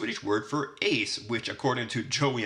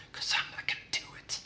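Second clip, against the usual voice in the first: fast coy whisper; whispered aside, teasing; 6 dB quieter, faster than usual. Real, not acted. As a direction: drop to a quick secret whisper. Coy aside, won't explain.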